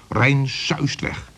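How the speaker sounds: ordinary delivery, nothing marked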